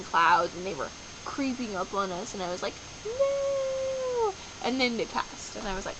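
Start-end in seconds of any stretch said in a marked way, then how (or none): 3.0-4.3 mock dismayed no. Storm clouds creeping closer.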